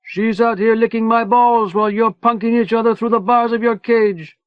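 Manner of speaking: high, loud jeering shout; Manny yelling filth at the prisoners; taunting yell. A narrator's reading. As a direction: jeer over the wall. Manny taunting prisoners over wall.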